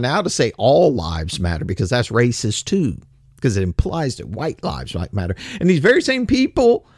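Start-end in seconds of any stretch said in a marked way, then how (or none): none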